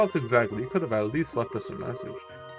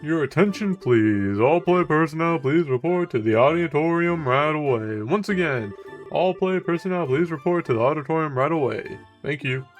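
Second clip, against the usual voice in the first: loud, high public address call; PA announcer; 3 semitones higher, 6 dB louder than usual. Acted. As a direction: announce it over the speakers. Reads a PA announcement.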